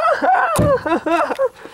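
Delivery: sudden high-pitched laugh. Real, not acted. Burst of laughter mid-fight.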